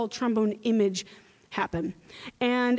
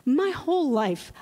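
usual speaking voice

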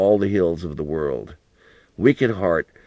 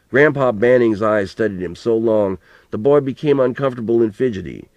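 plain reading voice

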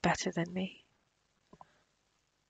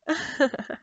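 whispered laugh; 7 semitones higher, 5 dB louder than usual. Acted. Villain amused by guest's fear.

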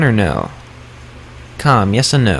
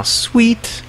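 ordinary talk, nothing marked

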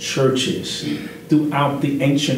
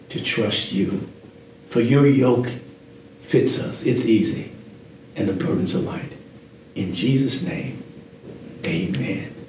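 closing prayer; 2 semitones lower than usual. Acted.

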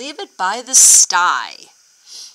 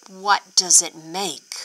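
ordinary delivery

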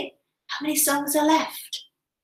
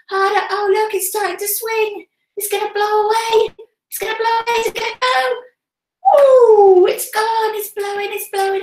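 much higher, thrilled cheering; kids show host; 8 semitones higher than usual. Acted. Urging kids to blow, sock flying.

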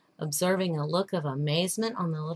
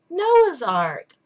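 put-on higher, louder little boy's answer; child replying; 7 semitones higher, 7 dB louder than usual. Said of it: "replied the child".

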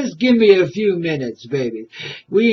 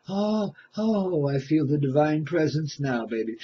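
ordinary talk, nothing marked

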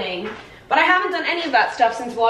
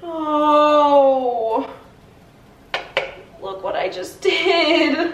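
surprised gasp; 5 semitones higher, much slower than usual. Real, not acted. Pancake mishap at the stove.

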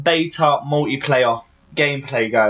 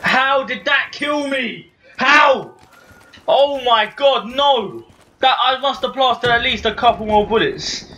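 high-pitched outraged disbelief; raging at the screen, voice way up; raging gamer; 7 semitones higher than usual. Real, not acted. Gamer dies, rages at screen.

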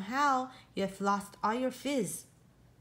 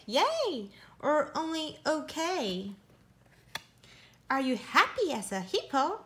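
ordinary delivery, nothing marked

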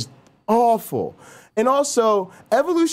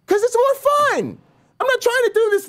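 high, gleeful burst; playful glee. Real, not acted. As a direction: squeal it with delight.

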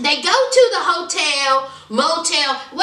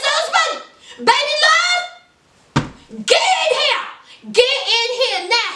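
much higher sing-song calling, with a laugh at the start; whiny demanding wife; 9 semitones higher than usual. Retold or imitated. Wife summoning her husband to the bathroom, high and bossy. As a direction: call him in high and bossy, then firmer on now. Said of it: "but she says".

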